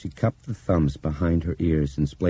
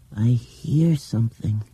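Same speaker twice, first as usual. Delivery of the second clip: put-on high, slow child voice, hushed; hushed dreamy girl; 7 semitones higher, slower than usual. A narrator's reading. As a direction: say it like a secret you only half believe. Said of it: "These whispered words".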